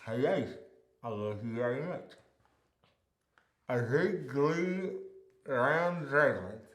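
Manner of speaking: slow mock mumble of nonsense; mock garbled speech. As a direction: mumble nonsense slowly as if mouth full. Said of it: "doesn't sound like this".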